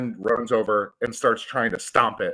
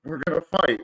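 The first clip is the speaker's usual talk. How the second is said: higher, deflated fight call; grim, higher-pitched we're gonna fight; resigned bravado; 7 semitones higher than usual. Acted. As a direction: say it like he's now outnumbered. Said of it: "and he was like".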